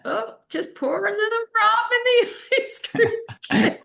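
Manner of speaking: high, laughing mock of the aunt; laughing Irish aunt. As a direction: squeal it out through laughter like a tipsy aunt. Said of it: "my Irish aunt".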